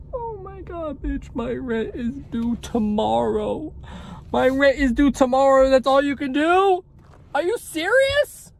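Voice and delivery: high, teary, breaking voice; crying. Real, not acted. Vlogger to viewers about rent due tomorrow, near tears. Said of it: "By the way, I'm not crying".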